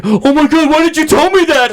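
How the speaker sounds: high-pitched, whiny alarm; mock panicked sister. Sister blaming her brother, high mock panic. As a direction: imitate a sister suddenly alarmed.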